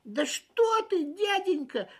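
high, loud, genuinely puzzled ant; sincere bewilderment. A narrator's reading. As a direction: exclaim in honest puzzlement. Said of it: "с искренним недоумением ответил муравей".

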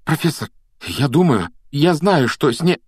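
high, halting confession; urgent explaining. A narrator's reading. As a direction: press on, then break off short. Harry urgently explains, stops short.

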